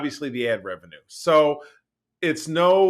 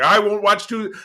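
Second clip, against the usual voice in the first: higher, louder mock refusal; indignant dad; 6 semitones higher, 5 dB louder than usual. Son voicing his dad's refusal of ads, mock indignation. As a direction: imitate Dad digging his heels in.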